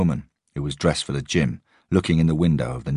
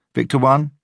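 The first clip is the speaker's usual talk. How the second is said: radio roll call; 10 semitones higher, 6 dB louder than usual. A narrator's reading.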